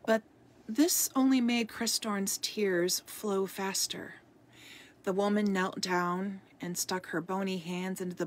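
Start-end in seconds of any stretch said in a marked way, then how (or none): none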